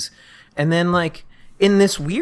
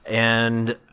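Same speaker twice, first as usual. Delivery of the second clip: ordinary talk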